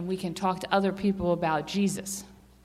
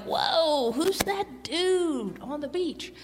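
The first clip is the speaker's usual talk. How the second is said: amazed fishermen; 6 semitones higher, much slower than usual. Retold or imitated. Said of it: "And they're like".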